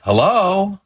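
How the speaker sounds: high, loud rising hello; answering the phone. Acted. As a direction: pick up with a loud, lilting question. Mother answers the door phone.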